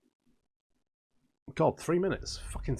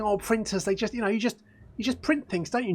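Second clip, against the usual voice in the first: higher, sing-song mock of the clueless; mocking naive people; 7 semitones higher than usual. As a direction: parody the naive printer fan, higher. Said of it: "Printers, people like to think".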